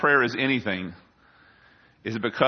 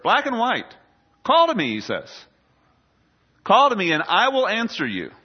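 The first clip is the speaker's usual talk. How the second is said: high-pitched, forceful scripture proclamation; impassioned preaching; 10 semitones higher, 5 dB louder than usual. Real, not acted. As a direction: urge them from the pulpit.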